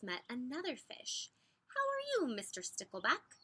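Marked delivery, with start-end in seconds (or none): 1.7-3.4 high, sing-song hello; cheery greeting. A narrator's reading. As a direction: call out a bright hello. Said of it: "asked Mister Seahorse".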